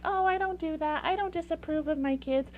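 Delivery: high, mock-defensive denial; defensive parent. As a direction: play the parent who insists they're fine. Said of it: "we're like".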